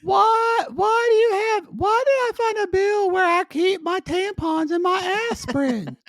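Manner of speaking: high mock wail of complaint; imitating Tater. Co-host plays Tater finding hidden bills, high mock wail. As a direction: caricature her, very high and insistent. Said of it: "I wanna see Tater saying".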